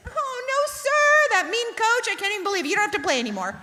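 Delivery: very high, hammed-up fussing squeal; wimpy coddling mom. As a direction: gush mock-outraged sympathy to comfort the boy. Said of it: "I just wanna do like this".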